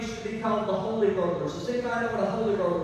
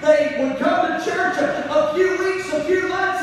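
raised, ringing preacher's cadence; full-force preaching; 4 semitones higher than usual. Acted. Pastor warns church about emotional faith, ringing sing-song preaching. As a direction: build the congregation up, half singing.

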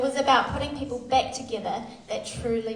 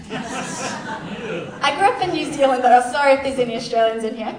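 laughing; 8 dB louder than usual. Real, not acted. Jokes about being Australian.

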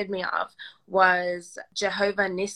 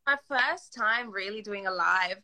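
ordinary talk, nothing marked

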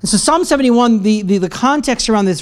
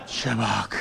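mumbled aside; 12 semitones lower, 14 dB quieter than usual. Shown as a demonstration. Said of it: "that's not gonna get you a date".

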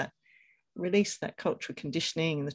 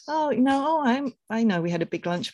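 high, louder opening, easing down; gentle reply example; 7 semitones higher, 6 dB louder than usual. Pretend. Mother explaining her hunger to a relative, high lilting reply. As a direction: start high like a sample friendly answer.

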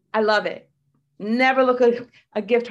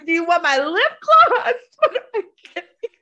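laughing through tears; 6 semitones higher, 7 dB louder than usual. Acted.

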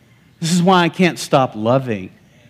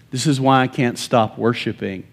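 usual speaking voice

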